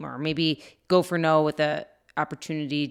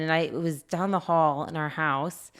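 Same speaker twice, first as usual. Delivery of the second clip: ordinary talk, nothing marked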